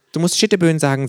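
hohe, verspielte Känguru-Stimme. A narrator's reading. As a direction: frech und hoch als Känguru. Känguru belehrt. (freche, hohe Känguru-Stimme)

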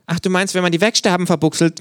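hohe, verspielte Känguru-Stimme. A narrator's reading. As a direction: frech und hoch als Känguru. (freche, hohe Känguru-Stimme)

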